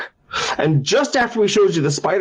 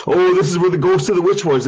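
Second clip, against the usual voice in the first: lightly hammed-up guide, raised pitch; tour guide patter; 4 semitones higher than usual. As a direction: present the haunted exhibit with mock drama. Tour guide announces spooky exhibit.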